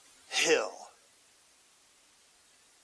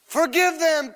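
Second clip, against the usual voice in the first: anguished cry; 9 semitones higher, 4 dB louder than usual. Acted. Jesus cries out during nailing.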